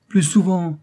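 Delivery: high, loud scornful refusal; insolent disdain. Acted. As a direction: toss it back with cheeky contempt. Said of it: "avec le plus insolent dédain".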